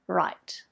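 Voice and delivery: "right" with an r sound replacing the L; r in place of L. Shown as a demonstration. Speech teacher showing parents a child's error, r for L in "right". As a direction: say "right", starting on r instead of L. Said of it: "mix it up with the r sound".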